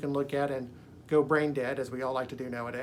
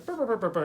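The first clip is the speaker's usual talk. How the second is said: higher playful burr noise; springy sound effect; 5 semitones higher, faster than usual. Retold or imitated. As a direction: bounce out the burrs lightly. Imitating bouncing serpent sound.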